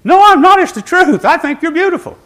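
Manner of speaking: high, loud insistent denial; emphatic protest. Real, not acted.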